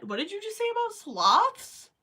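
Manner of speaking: high, loud, disbelieving question; shocked question. Real, not acted. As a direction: demand it in mock outrage. Reacting to chat about sloths.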